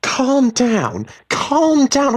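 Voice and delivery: very high, loud screech; incredulous, furious shriek; outraged echo. A narrator's reading. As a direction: throw the words back in fury. Wife throws his words back furiously.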